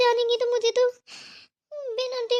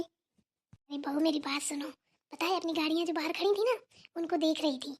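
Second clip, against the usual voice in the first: hushed aside; 4 semitones lower, 7 dB quieter than usual. Acted. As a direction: keep it down, just between us. Mother-in-law pulls daughter-in-law aside. (बहू को धीमे से फुसफुसाकर समझाती सास)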